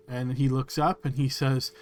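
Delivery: ordinary talk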